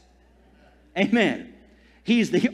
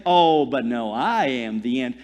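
low mock voice of circumstances; 8 semitones lower than usual. Circumstances personified as a voice.

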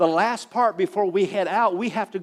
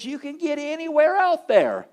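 upbeat reassurance; 9 semitones higher, 4 dB louder than usual. Retold or imitated. Confident man reassures about dunes.

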